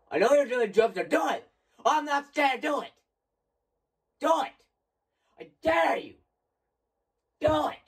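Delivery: high, loud nervous taunt; defiant shouting dare. Real, not acted. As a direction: yell at the game to bring the scare. Daring the game again, shouting.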